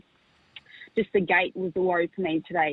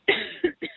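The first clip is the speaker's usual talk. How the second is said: sudden harsh cough; coughing fit; 13 semitones higher than usual. Real, not acted. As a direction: cough hard mid-sentence. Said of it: "pardon me".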